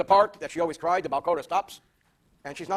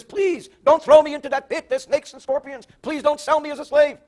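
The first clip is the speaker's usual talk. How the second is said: high, raised pleading voice; 7 semitones higher, 6 dB louder than usual. Retold or imitated. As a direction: act out a brother begging for mercy. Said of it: "when he pleaded with us".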